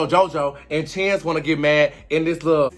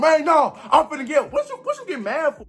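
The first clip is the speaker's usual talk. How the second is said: high, mocking imitation of a man getting mad; mock angry Micah; 10 semitones higher than usual. Mocking Micah as he gets mad at Skittles. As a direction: ham up his outburst, pitched way up.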